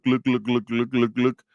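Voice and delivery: imitated glugging of a gas pump; gas pump gluck noises. Comic imitating the gas pump filling his car, glugging. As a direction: mimic fuel glugging into the tank. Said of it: "stop at a gas station and gluck".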